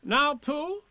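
high, officious rabbit voice; taking command. A narrator's reading. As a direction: give orders like a small organiser. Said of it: "it was time he took command".

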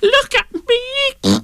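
slow, drawn-out high squeal; shouting. Acted. Piglet demanding Kanga look at him, squealed shout. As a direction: squeal each word in exasperation. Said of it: "shouted Piglet".

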